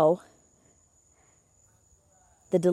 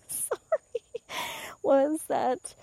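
high voice breaking into real laughter; laughing; 8 semitones higher, much slower than usual. Real, not acted. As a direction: apologize through helpless laughter. Cracks up at own tangent.

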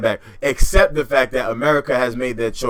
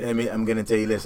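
ordinary talk

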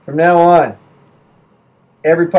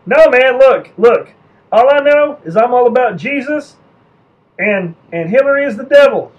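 high, loud mock yokel; stubborn believer; 7 semitones higher, 4 dB louder than usual. Believer refusing the propaganda explanation, high insistent yokel voice.

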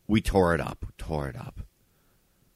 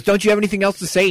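angry demand; 13 semitones higher, 9 dB louder, faster than usual. Retold or imitated. Angry girlfriend confronting him.

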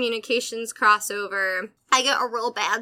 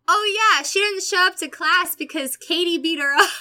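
put-on high, louder eager kid voice; mock tattling kid; 6 semitones higher, 5 dB louder than usual. Classmate telling the teacher why Danielle is gone, high and eager. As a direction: pipe up like a gossiping kid. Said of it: "pipes up and goes".

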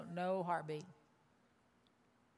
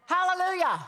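high, loud, ringing hallelujah; a preacher's high shouted hallelujah; 9 semitones higher, 8 dB louder than usual. Real, not acted. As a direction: burst out in praise. Preacher's exclamation mid-sermon.

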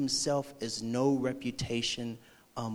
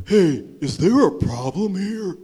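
loud, higher-pitched mock challenge; mock confrontational; 5 semitones higher, 10 dB louder than usual. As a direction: butt in like a cocky bar bully. Acting out bar bully butting in.

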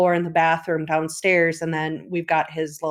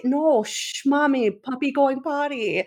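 put-on shushing toddler; 6 semitones higher than usual. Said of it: "I'm like".

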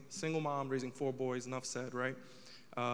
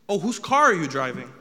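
louder, pushy mom question; imitating mom; 11 dB louder than usual. Son acting out mom grilling him about the car, louder. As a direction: bark out the mom's question.

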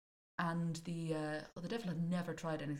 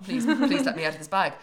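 loud, higher mock plea; hammed-up devil pleading; urgent begging; 6 semitones higher, 13 dB louder than usual. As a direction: beg desperately to be let out. Queasy devil begs to be released.

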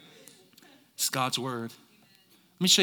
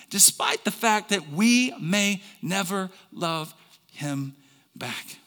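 ordinary delivery

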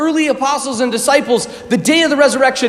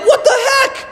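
high, laughing mock disbelief; 11 semitones higher than usual. Apostles hearing the news, high, laughing disbelief. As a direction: squeak it out, amused and stunned. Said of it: "They were like".

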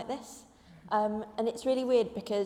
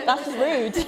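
loud, higher, playful indignation; mock outrage; 4 semitones higher, 9 dB louder than usual. Student mistaken for a Londoner, mock-outraged. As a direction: protest loudly as if insulted, half laughing.

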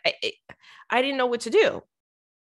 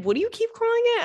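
higher, puzzled question; puzzled owner; 14 semitones higher, faster than usual. Retold or imitated. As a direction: ask like a confused shop owner.

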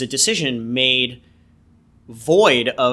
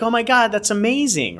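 much higher, thrilled mock reaction; imagined delighted mom; 10 semitones higher than usual. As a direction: gush as the mother he hoped for.

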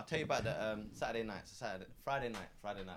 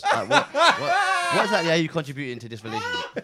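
louder, laughing mock questioning; mock wife, laughing; 16 dB louder than usual. Mocking a teacher's wife over the payslip, laughing. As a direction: play the wife grilling him while cracking up.

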